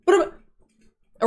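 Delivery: ordinary talk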